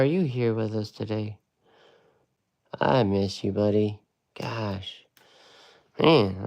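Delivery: ordinary talk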